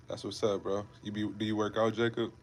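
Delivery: ordinary talk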